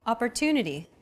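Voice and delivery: Opportunity with a longer, stressed "tu". Shown as a demonstration. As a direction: lean on the third syllable of Opportunity. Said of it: "Compare this once again with Arabic".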